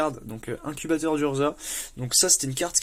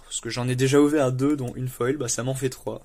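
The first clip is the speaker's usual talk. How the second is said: ordinary delivery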